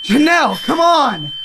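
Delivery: high, urgent calling; urgent plea. Acted. Friend calling a companion to escape, urgent high plea. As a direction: urge her on in alarm.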